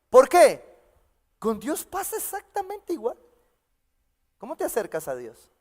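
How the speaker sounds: ordinary delivery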